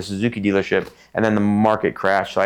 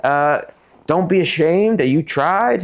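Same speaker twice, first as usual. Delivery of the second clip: higher voice of a fatherly mentor, retold; imitating Jim Click; 8 semitones higher, slower than usual. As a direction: counsel him like a son, pitched up. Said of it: "And he's like".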